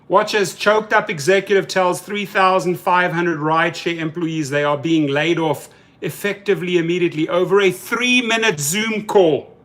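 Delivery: ordinary talk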